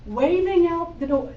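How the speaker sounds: ordinary talk, nothing marked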